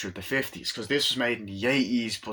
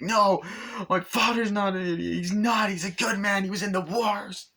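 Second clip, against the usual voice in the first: higher, choked defence of his father; upset protest; 10 semitones higher than usual. Retold or imitated. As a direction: insist through hurt.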